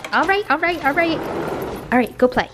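high sing-song pet voice; talking to a dog. Real, not acted. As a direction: shoo the dog out playfully. Sending dog out to play.

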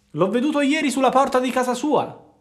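a fox baiting a puppet; sly fox. A narrator's reading. Fox talks to Pinocchio. (la volpe furba, voce molto più alta e forte)

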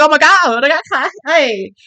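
high, loud, sassy hype; sassy excitement. Retold or imitated. Fan reacting to the singer's sassy voice, high loud hype. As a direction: cheer her on, sassy. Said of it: "I'm like".

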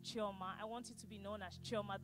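ordinary delivery

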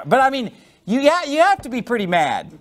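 ordinary talk

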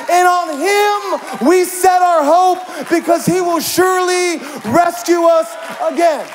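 loud, rising sermon climax; preaching at full force. Acted. Pastor preaching hope to the church, loud and rising. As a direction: preach it out to the congregation with building fire.

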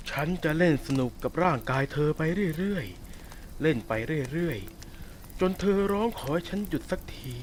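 drawn-out, slow threat; slow, savoured menace. Acted. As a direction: stretch each word out to savour her fear. Wolf threatens captive slowly.

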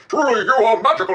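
high-pitched amazed king voice; excited king. Acted. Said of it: "he's so excited, he can't believe it".

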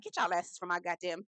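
ordinary talk

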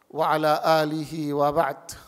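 usual speaking voice

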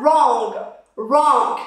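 wrong said as rong, no W; silent W. Shown as a demonstration. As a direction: drop the W in wrong. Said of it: "the W at the beginning is silent".

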